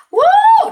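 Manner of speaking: loud, high "woo"; big high-pitched cheer; celebratory whoop. Retold or imitated. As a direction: whoop like the family marching in to celebrate. Family celebrating Aunt Vi's victory.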